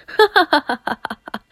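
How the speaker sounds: loud, high mock villain cackle; hammed-up evil laugh; evil laughter. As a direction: cackle in triumph, high then falling. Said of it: "Evil laughter insert here".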